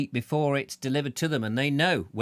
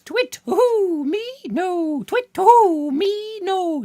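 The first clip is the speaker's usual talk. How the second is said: owl hoot; 7 dB louder, much slower than usual. Pretend. Viewer is a wise owl.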